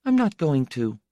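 put-on high-pitched small girl, mildly indifferent; indifferent child. A narrator's reading. Small girl refusing to leave with the family, indifferent. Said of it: "she replied with indifference".

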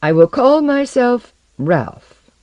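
high, loud, drawn-out proclamation; firm declaration. A narrator's reading. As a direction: proclaim the chosen name with resolve. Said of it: "he stated firmly".

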